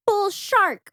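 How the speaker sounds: high-pitched exclamation, like a curse; mock indignant outburst. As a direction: blurt it out high, imitating an outraged girl. Mock outburst of angry character.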